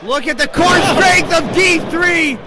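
excited shout. Real, not acted. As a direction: hype up the strength on display. Impressive move in the match.

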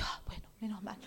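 a whispered sigh of relief; relieved whisper. Retold or imitated. Speaker tells her boss she is relieved, whispering. Relief after boss's praise.

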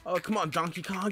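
usual speaking voice

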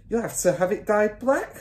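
high-pitched matter-of-fact instruction; mother's voice. A narrator's reading. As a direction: tell him as the wife.

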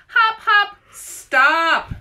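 high, loud fed-up shout. A narrator's reading. Frustrated Arnold stopping the game, loud shout. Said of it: "shouted Arnold".

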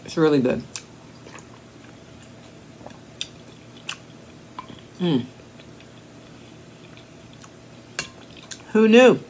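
chewing between words; swallowing while speaking; mouth full of soup. Real, not acted. Seen 2: Sips soup just before; chews and swallows while saying words.

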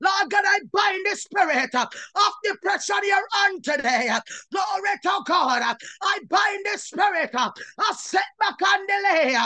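usual speaking voice

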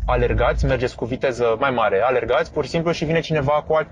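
ordinary talk

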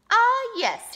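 high, loud burst of remembering; sudden recollection. A narrator's reading. Jackrabbit recalls what the Great Spirit said, brightly certain. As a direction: pipe up as it comes back to you.

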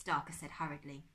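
usual speaking voice

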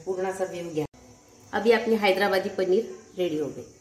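normal talking voice

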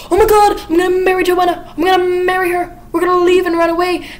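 high, loud, breathless excitement; giddy outburst. Retold or imitated. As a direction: burst in shouting with joy. Anthony bursting in, overjoyed.

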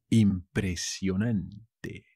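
"Impresionante" slow, last syllable set apart; teacher's slow, careful "Impresionante"; slow demonstration. Shown as a demonstration. New word shown slowly to learners.

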